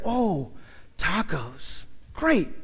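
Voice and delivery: very soft, halting mock enthusiasm; underwhelmed reaction. Newcomer from Holland to cook about unknown dish, underwhelmed. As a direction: trail down low, then a small lifted reply.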